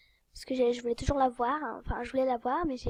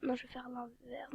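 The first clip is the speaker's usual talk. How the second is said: whisper; 13 dB quieter than usual. Real, not acted. Whispers change of mind.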